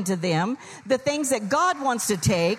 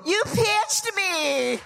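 put-on high, slow whining kid's wail; drawn-out childish complaint; whiny child; 10 semitones higher, much slower than usual. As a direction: wail it like an outraged little kid.

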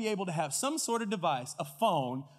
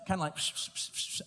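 imitated sniffing. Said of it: "sounds like a big dog rubbing his nose".

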